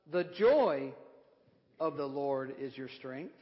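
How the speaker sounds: "joy" colpita forte, il resto più basso e lento; stress on joy. Shown as a demonstration. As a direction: punch the word joy, then let it drop. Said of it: "if you emphasize".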